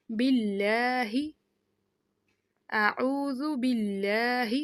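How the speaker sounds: باللہ read very slowly with the lam held; اعوذ باللہ drawn out syllable by syllable; slow recitation. Shown as a demonstration. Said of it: "تھوڑی دیر رہ کے، ٹھہر کے پڑھنا ہے". (اعوذ باللہ آہستہ، لام پر شد کے ساتھ)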